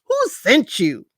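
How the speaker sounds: hammed-up who sent you; exaggerated demand. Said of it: "that was all me exaggerating".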